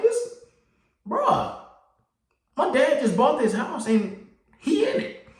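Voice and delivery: can't believe this guy; indignant rant. Retold or imitated. Mad about stranger in house.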